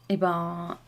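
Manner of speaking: ordinary delivery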